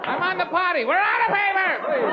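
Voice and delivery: very high, hammed-up cry for help; distressed grandmother. Grandmother calls for help from the potty, mock-panicked. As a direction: wail helplessly like a panicked granny.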